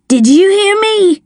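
high, insistent bullying question; angry demand. A narrator's reading. Drunken man pressing a hesitant woman, angrily. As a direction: demand to be obeyed, pitch jumping up.

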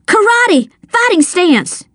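high, urgent battle cry; shouted command. A narrator's reading. Child rallying a friend to fight, shouting. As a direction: call out orders, pitch way up. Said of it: "I shouted".

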